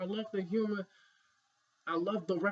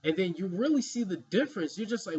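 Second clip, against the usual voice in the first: usual speaking voice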